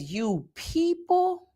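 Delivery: high, very slow drawn-out mock voice; mocking the clerk. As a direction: drag it out in disdain. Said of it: "When she said".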